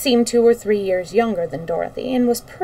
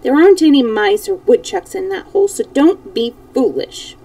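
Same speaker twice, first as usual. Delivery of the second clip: scolding; 8 semitones higher, 4 dB louder than usual. A narrator's reading. Dorothy scolding the dog Toto.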